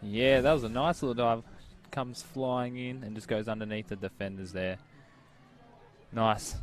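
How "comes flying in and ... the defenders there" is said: ordinary delivery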